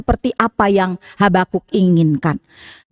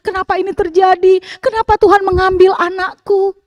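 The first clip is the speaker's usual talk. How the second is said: higher, louder wailing plea to God; acted lament; 8 semitones higher, 3 dB louder than usual. Preacher acting a mourner's wailing prayer to God, higher and louder. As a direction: cry out to God like a grieving widow. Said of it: "kita meratap kepada Tuhan".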